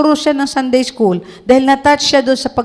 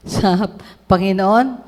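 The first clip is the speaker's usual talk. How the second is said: ordinary talk, nothing marked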